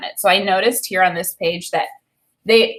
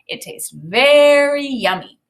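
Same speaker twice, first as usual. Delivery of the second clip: hammed-up yummy; 6 semitones higher, 5 dB louder, slower than usual.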